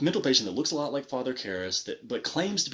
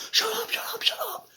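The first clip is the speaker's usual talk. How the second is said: frantic high-pitched mock yell; mock upset outburst; 3 dB louder than usual. Imitating the actor silencing a guy, very upset. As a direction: squeal it over and over, imitating a meltdown. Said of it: "gets so upset with this guy".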